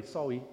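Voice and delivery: "Sorry" said "sowwy", r softened to w; granddaughter's funny accent. Retold or imitated. Two-year-old knocking you out the way, "Sorry" as "sowwy" in a funny accent. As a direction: say "Sorry" like a toddler who can't manage the r. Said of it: "she says in a funny accent".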